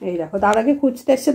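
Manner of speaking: ordinary delivery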